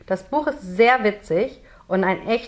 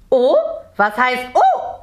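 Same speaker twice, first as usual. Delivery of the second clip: loud, raised, slow mocking echo; angry; 5 semitones higher, 5 dB louder, slower than usual. A narrator's reading. Merman objects to Jonathan's reaction, angry. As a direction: snap the word back indignantly, drawn out. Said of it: "sagt der Nix böse".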